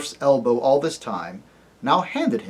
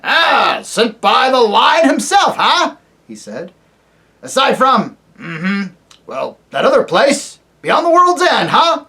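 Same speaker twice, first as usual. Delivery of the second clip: put-on high, loud querulous old dwarf; deaf old dwarf; 9 semitones higher, 7 dB louder, slower than usual. Deaf dwarf to the owl, loud querulous old man. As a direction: bark it like an old man hard of hearing.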